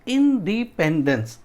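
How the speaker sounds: इंडिपेंडेंस measured out: इन, डी, पेन, डेंस; syllable breakdown. Shown as a demonstration. Teacher showing how the word breaks, syllable by syllable. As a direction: give each part of इंडिपेंडेंस its own beat. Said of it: "उसको break कर देते हैं".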